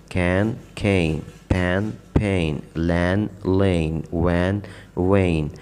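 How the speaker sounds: کین then کھین, short a then gliding ay, slowly; slow teacher contrast of short and long vowel; contrasting vowel pairs. Shown as a demonstration. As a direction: say each pair slowly, showing the vowel change. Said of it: "اس کو کین پڑھیں".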